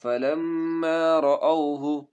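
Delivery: melodic chanted tajweed recitation; Quran recitation. Shown as a demonstration. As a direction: recite slowly and musically, stretching vowels.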